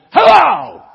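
huge, high furious shout; violent roar. Acted. Exasperated man roaring into the phone, furious shout. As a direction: roar it in rage. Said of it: "a violent man roared".

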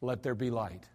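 low, soft, plain command; quiet spoken command; simply said. Shown as a demonstration. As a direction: say it calmly, it happens anyway. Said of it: "I think he said".

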